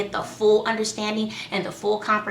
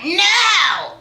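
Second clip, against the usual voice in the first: put-on much higher, louder toddler "No!"; child's defiant no; 10 semitones higher, 6 dB louder than usual. Mom imitating her daughter's defiant no, high and loud. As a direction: snap back like a defiant little girl. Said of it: "She was like".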